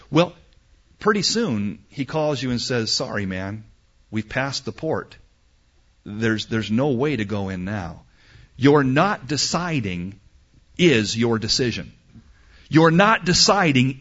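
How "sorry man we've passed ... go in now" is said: low, soft regret; apologetic. Acted. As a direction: break bad news quietly as the captain. Captain breaking bad news to passenger.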